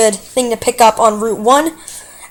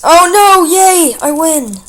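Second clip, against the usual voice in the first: excited cheer; 6 semitones higher, slower than usual. Real, not acted. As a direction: shout it out in surprised relief. Surprise win in battle.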